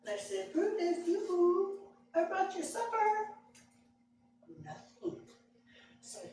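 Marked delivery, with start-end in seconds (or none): 0.5-3.3 high, lilting call to a hidden dog; high sing-song yoo-hoo to a dog; coaxing sing-song call. Retold or imitated. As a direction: coo his name up high to tempt him out.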